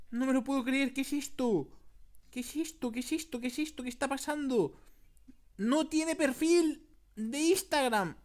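high-pitched, hammed-up bafflement; shocked disbelief. Gamer finding no Instagram profile, shocked disbelief. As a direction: build mock shock to a loud finish.

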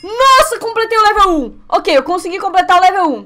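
high, loud victory yells; triumphant shout. Real, not acted. Streamer finishing level one, shouting high with joy. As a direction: cheer as you hit the button. Reaches the button, level done.

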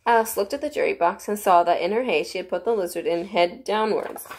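ordinary delivery

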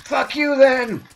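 loud, high, slow angry curse; angry shout. Acted. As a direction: spit it out in fury. Angry after refusal.

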